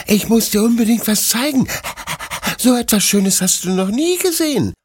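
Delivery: very high little dog voice, bursting with news; excited puppy character. Acted. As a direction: speak as an eager pup.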